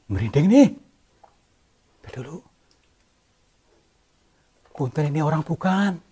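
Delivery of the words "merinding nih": spooked. Real, not acted. Goosebumps after the fright.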